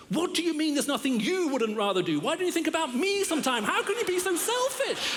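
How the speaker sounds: high and rising, a mock shrill wife; indignant wife. Husband imitating his wife scolding him. She never looks at me and says.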